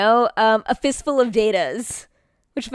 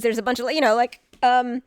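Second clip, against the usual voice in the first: ordinary talk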